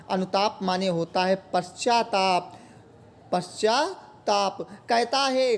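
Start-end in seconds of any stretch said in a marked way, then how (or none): none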